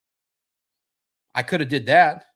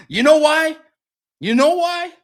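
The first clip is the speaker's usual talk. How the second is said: high, loud, teasing question; loud hype; 13 semitones higher, 8 dB louder than usual. Real, not acted. Hyping his viewers with a loud, high teasing question.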